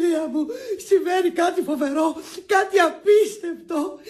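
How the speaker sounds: tearful, wailing announcement of disaster; hammed-up weeping; crying. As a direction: break dreadful news through sobs. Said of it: "τι έχεις, γιατί κλαις".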